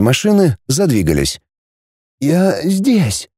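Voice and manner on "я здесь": higher, faint-hearted reply; weak voice. A narrator's reading. Boy hidden in a cupboard answers, weakly. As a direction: answer feebly from a hiding place. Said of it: "послышался слабый голос".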